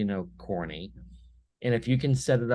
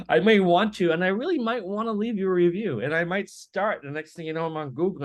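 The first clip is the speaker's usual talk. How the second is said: laughing; 9 semitones higher, 4 dB louder than usual. Real, not acted. Laughing over his own story.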